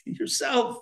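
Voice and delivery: normal talking voice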